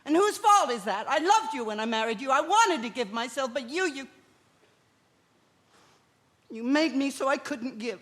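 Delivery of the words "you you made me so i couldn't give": ordinary talk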